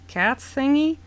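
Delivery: normal talking voice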